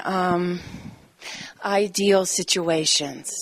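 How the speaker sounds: ordinary talk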